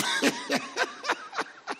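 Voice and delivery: long, high-pitched laugh. Real, not acted. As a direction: let a big laugh run on. Laughs at own story.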